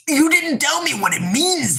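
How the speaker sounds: very high exasperated protest; frustrated outburst. Real, not acted. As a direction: burst out in high-pitched frustration.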